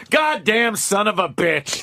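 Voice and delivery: high, slow retold cursing; mock exasperated driver swearing; pissed. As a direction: curse slowly, tangled in a cord. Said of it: "It's all pissed".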